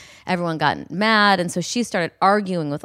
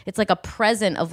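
usual speaking voice

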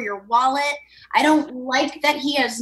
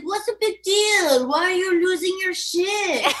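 breezy mock French accent, pitched a bit higher; French guy impression; 5 semitones higher, slower than usual. Cohost mocking the relaxed French boyfriend, breezy French accent. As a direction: brush her off like a careless French guy. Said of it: "That's a good impression".

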